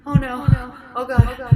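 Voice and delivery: rising panic as things go wrong; panicked. Retold or imitated. Panicked inner cry.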